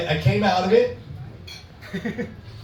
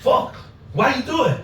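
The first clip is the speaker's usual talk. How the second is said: put-on Russian accent; 7 semitones higher than usual. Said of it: "my Russian friend".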